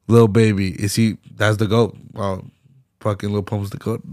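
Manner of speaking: normal talking voice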